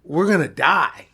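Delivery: loud, slow urgent yell to wake him; retold yelling at friend. Driver yelling at his sleeping friend to wake up, urgent loud yell. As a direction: shout him awake, slowly, like the car is going over. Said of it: "I say".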